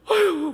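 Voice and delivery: high, loud mock wail of a mother; wailing sigh. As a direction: cry out like a grieving mother. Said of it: "울어도". (엄마가 우는 소리를 흉내 내며 높고 크게)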